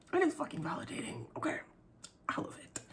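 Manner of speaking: high, unvoiced whisper. Real, not acted. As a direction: breathe it out as a secret aside. Emotional aside, whispered.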